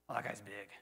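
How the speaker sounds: low, hushed awe at the giant; a low impressed mumble; awed murmur. Retold or imitated. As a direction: mutter it like a wide-eyed onlooker. Israel's men awed by the giant.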